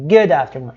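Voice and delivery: "good afternoon" with no ee after "good"; silent d. Shown as a demonstration. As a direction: link a short d of "good" straight into "afternoon". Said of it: "É assim que você vai usar". ("good afternoon" sem i depois do d)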